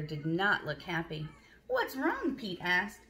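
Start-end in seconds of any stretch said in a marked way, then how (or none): 1.7-2.4 much higher, concerned asking; caring question. Acted. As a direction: ask high and gentle. Pete asks Alligator.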